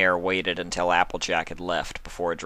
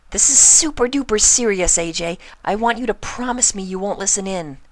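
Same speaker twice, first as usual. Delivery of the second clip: high, loud and earnest pony voice; urgent Pinkie; 14 semitones higher, 6 dB louder than usual. Acted. Pinkie making Applejack promise, high and urgent. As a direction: insist hard, way up high.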